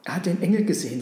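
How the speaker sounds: ordinary delivery, nothing marked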